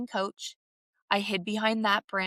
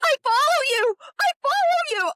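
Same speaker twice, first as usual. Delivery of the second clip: high, loud, trembling gush; starstruck fan; 6 dB louder than usual. Retold or imitated. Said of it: "she started shaking".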